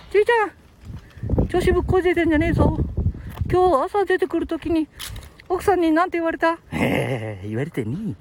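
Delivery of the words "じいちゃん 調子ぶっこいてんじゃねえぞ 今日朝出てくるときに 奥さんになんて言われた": high-pitched puppet telling-off; high, cheeky character voice in mock reproach; scolding puppet sidekick. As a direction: nag Grandpa as a bossy little dummy.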